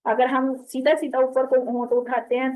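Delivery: usual speaking voice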